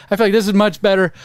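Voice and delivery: ordinary talk, nothing marked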